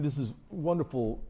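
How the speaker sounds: ordinary talk, nothing marked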